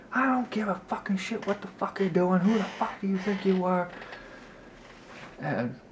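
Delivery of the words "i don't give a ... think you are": high, shrill-free screeching rant, pitched well up; angry old neighbour screaming from her doorway; mock furious yelling. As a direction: play the furious neighbour tearing into him, voice way up. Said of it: "she starts yelling".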